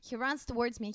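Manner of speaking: ordinary delivery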